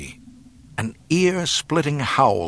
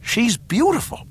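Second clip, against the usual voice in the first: very high pleading voice; desperate persuasion; 3 dB louder than usual. A narrator's reading. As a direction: plead your case, pitched way up. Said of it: "Luke persisted desperately".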